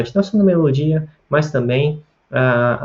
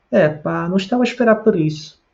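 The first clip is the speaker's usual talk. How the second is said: quick, high-pitched Portugal Portuguese melody; European Portuguese accent; 7 semitones higher than usual. Shown as a demonstration. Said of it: "tento imitar a pronúncia dos portugueses". (sotaque de Portugal, rápido e agudo)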